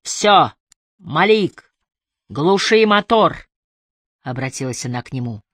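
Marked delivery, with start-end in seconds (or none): 0.1-3.5 slow, firm call to a suspect; megaphone order. A narrator's reading. Emily orders Malik to cut the engine, loud megaphone call. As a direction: order him to stop, drawing the words out. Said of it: "взяла мегафон".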